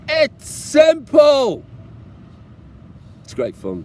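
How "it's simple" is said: much higher, very loud, drawn-out slowly; emphatic shout. Real, not acted. Biker telling viewers travel is easy, drawn-out shout. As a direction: bellow it as a punchline.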